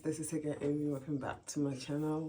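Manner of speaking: sleepy morning voice. Real, not acted. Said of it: "please excuse my morning voice".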